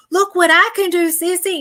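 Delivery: put-on a proud little boy after a trick. Little brother shows off to his big sister in a high, eager voice.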